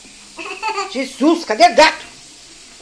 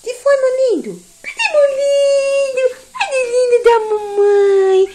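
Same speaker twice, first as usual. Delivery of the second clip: squeaky praising a dog; 8 semitones higher than usual. Real, not acted. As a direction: coo at the dog.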